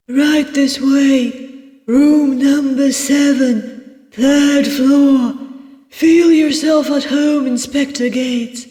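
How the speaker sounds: high, slow, projected host voice; hospitable innkeeper. Acted. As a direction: show the guest in, pitched well up and unhurried. Voices innkeeper Barrow in a story.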